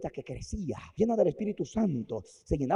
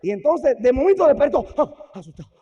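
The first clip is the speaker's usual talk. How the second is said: alarmed telling; 9 semitones higher, 10 dB louder than usual. A narrator's reading. Wesley wakes in fright.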